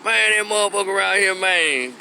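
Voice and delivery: mock a brother hating in Memphis slang; Memphis hating talk. Memphis man hating on a bookish guy, drawled and mocking. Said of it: "we'll say something like".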